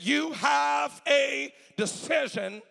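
high, loud, slowly stretched declaration; emphatic preaching shout. Retold or imitated. Doctors tell mother to decide, preached as a drawn-out shout. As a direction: hammer out each word slowly and loudly.

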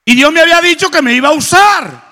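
loud, high proclaiming shout; preaching shout. Real, not acted. Preacher proclaiming his calling to the congregation, full shout. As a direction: cry it out to the church.

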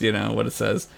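normal talking voice

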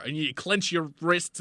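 ordinary talk, nothing marked

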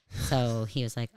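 usual speaking voice

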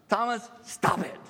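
Jesus rebuking Thomas, scolding. Retold or imitated. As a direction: call him out like a parent. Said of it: "he's like".

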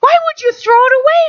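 high, pleading outrage; indignant plea. Retold or imitated. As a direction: push back in disbelief. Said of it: "I said".

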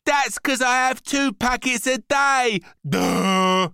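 loud, high bratty retort; scornful boy. A narrator's reading. Boy explains biscuits to mum, loud bratty scorn. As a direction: shout it like a know-it-all kid.

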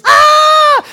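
a piercing scream, very high and loud. Retold or imitated. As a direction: let out a sudden terrified scream. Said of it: "oigo unos gritos".

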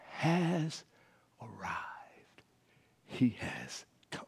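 very slow, hushed proclamation; awed whisper. Acted. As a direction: drop to a whisper with pauses between phrases. Preacher declares the king's arrival.

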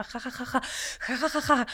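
acted laughing fit. Laughing alone at something just done, acted laughter. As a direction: laugh away quietly at your own joke.

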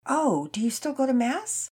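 high, slow curious question; piqued interest. A narrator's reading. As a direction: ask with keen, drawn-out interest. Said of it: "That piqued Mama's interest".

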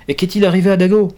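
alarmed question. Acted. Worried question about the dog.

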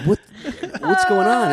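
high-pitched imitated bewilderment; mock alarm. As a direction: act out confused friends, voice way up. Imitating the fan's alarmed friends.

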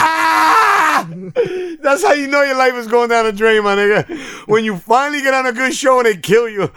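high, loud exasperated rant; loud outrage. Real, not acted. Ranting about an actor killed off, loud and high. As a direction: yell it in mock disbelief.

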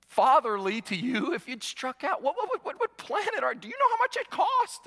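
from a hushed plea to high, astonished protest; incredulous parent. Pretend. Father pleads with son after baseball, hushed then incredulous. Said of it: "you would get down on your knee and you would say".

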